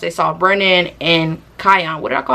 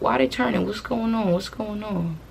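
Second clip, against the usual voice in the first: soft, nosy murmuring to oneself; real quiet aside, attention elsewhere; distracted hushed aside; 9 dB quieter than usual. Real, not acted. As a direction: wonder aloud under your breath. Distracted, watching something outside.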